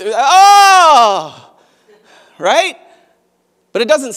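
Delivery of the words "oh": sudden high loud "Oh!" of dismay; mock shocked cry. Imagined Jesus reacting to Peter, a mock cry. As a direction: cry out like someone let down. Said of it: "'Cause you imagine, right? Jesus looking at".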